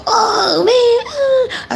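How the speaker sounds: put-on high, whiny groan of kids; whining kids. Imitating students groaning at a cleanup game, high whine. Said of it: "and they'll go".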